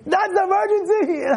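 high, incredulous mock protest; hammed-up exasperation; mock outrage. Acted outrage at trivial request.

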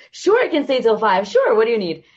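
high, loud, over-eager agreement; eager yes. Retold or imitated. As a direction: pitch it up and jump at the offer. Eagerly agreeing to stay late.

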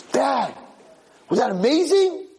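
high-pitched, thrilled little-son voice; excited kid. Acted. Kid to his father after a missed swing, excited. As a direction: squeal it to Dad, delighted.

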